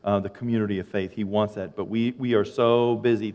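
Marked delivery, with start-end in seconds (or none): none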